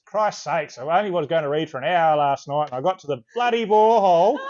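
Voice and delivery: loud, mock-indignant complaint; exasperated. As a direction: grumble it loudly, building to the last words. Reads out an exasperated listener message.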